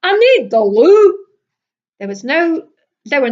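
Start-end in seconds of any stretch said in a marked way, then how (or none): 0.0-1.2 loud, high broad Scottish announcement; Scottish friend waking you at night, loud broad Scottish; broad Scottish accent. A narrator's reading. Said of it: "announced in her broad Scottish accent".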